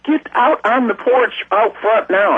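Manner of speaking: high, drawn-out imitated holler; retold hollering. Sending the family out to see the saucer, imitated hollering. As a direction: re-enact urgent ordering, pitched high and slowed. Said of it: "hollering to the top of my lu-, lungs".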